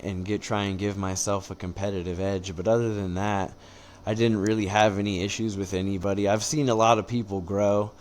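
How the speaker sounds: ordinary delivery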